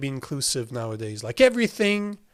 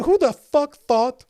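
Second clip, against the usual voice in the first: higher and louder, outraged; exasperated; 9 semitones higher, 5 dB louder than usual. Real, not acted. Streamer ranting at rebrand, exasperated outburst. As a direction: vent at the genius who named it.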